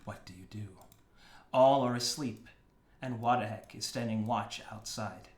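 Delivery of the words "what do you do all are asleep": ordinary talk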